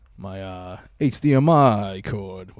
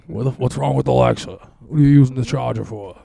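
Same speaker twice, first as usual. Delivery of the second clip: imitating dad; 2 semitones higher, 6 dB louder than usual. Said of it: "be like".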